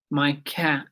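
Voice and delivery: ordinary delivery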